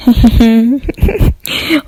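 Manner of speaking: acted creepy little chuckle; creepy laugh. A narrator's reading. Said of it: "I laughed creepily".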